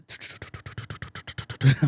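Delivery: breathy laugh. Real, not acted. Laughs after joke.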